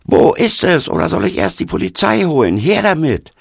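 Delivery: high-pitched, loud, furious roar; angry bellowing. A narrator's reading. Stocksauer young man demanding his drums back, bellowing angrily. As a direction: bellow it in outrage. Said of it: "brüllte er zornig".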